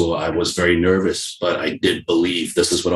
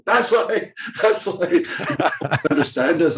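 high-pitched, laughing through the words; 10 semitones higher, slower than usual. Real, not acted. Wrestler cracks up at his own countrymen's accent, laughing. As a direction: crack up while talking.

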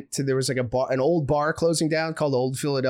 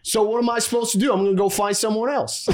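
higher-pitched mock Philly guy, arguing back; Northeast Philly dude; 5 semitones higher, faster than usual. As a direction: retort like an exasperated Philly dude, imitated. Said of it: "just Northeast Philly dudes hanging out".

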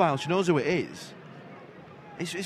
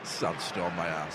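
scoffing; 11 semitones lower, 9 dB quieter, much slower than usual. Real, not acted. Scoffing at sandstorm claim.

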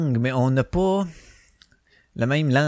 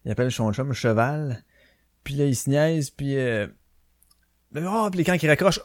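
ordinary talk, nothing marked

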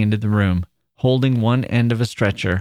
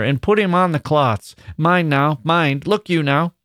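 put-on higher, curt old manager's order; manager snapping; 6 semitones higher than usual. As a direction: snap out the order like an old foreman. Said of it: "snapped the manager".

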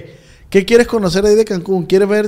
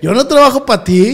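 higher-pitched mock indignation; indignant protest; 5 semitones higher, 4 dB louder than usual. Host protests being called Ramón's employee, indignant. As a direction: push back in a raised, offended voice. Mock quarrel over who is boss.

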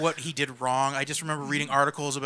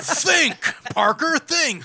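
barking newspaper editor; 11 semitones higher, much slower than usual. Retold or imitated. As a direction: snap the order at him. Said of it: "It was J K Simmons".

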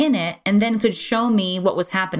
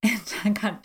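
usual speaking voice

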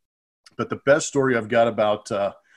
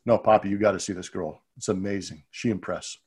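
softer, lower hushed urging; hushed insistence; 5 semitones lower, 6 dB quieter than usual. Retold or imitated. Tejada urging him about a girl, hushed and low. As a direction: lean in and hush it. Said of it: "He goes".

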